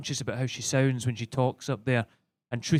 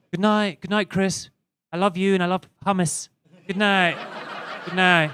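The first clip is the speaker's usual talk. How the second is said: higher-pitched, dainty posh bedtime voice; adorable posh wife; 3 semitones higher than usual. Acted. Wife bidding husband good night at bedtime, adorable posh voice. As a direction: play a sweet, super-posh wife saying good night. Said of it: "We go to bed in the evening. She's adorable".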